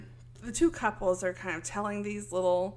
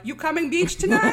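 loud, high mock Greek-accented broken English; 9 semitones higher, 10 dB louder than usual. Greek guys asking Americans to the beach, mock broken-English accent. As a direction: shout it like a Greek tourist with little English. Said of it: "they don't speak English well".

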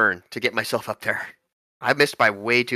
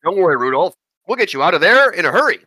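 heroic call; 5 semitones higher, 5 dB louder than usual. Acted. Character reassures Rudolph.

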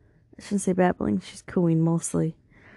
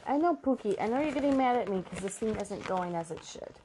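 cooing to pet; 2 semitones higher, 8 dB quieter than usual. Real, not acted. Soothing the pet, Pookie.

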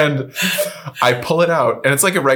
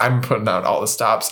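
normal talking voice

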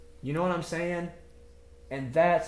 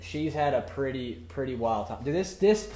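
ordinary delivery

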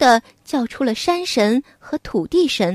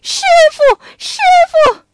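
much higher, loud desperate call; begging cry; 5 dB louder than usual. Acted. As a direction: plead on your knees for mercy. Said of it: "连忙跪下来求饶".

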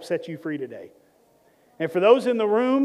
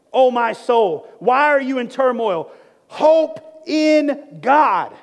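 loud, high, emphatic preaching cry; commanding the soul; 5 semitones higher, 6 dB louder, slower than usual. Acted. Preacher acts David commanding his soul to hope, loud and forceful. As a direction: order your own soul back with full force. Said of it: "command your snow, your soul to snatch back".

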